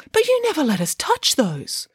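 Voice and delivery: high piglet protest; mild exclamation. A narrator's reading. Piglet questions mother about good scissors, mild exclamation. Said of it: "exclaimed the piglet".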